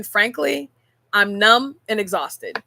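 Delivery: slow, heavy stress on numb; numb and exhausted, slowly. Shown as a demonstration. Telling a friend how she really felt, slow and heavy. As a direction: let numb land with a weary fall. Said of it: "My fine would have been".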